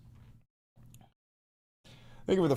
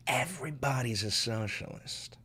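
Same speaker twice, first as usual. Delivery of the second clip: hushed repeat; 3 semitones lower, 7 dB quieter than usual. Real, not acted.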